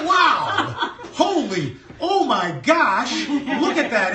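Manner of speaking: high, loud mock amazement with drawn-out words; awestruck exclamation. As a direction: exclaim as if stunned by the sight, hammed-up. Acts out amazement at the birth.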